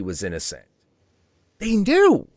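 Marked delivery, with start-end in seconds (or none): 1.6-2.3 high, louder, slowed indignation; nearly shouting the betrayal; a furious, pitched-up "They fucking knew"; outraged. Real, not acted. Outraged at deliberate smear.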